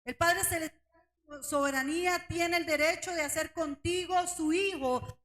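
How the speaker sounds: ordinary talk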